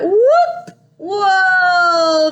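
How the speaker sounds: whoosh of surprise. Acted. Baby flying out of mouth.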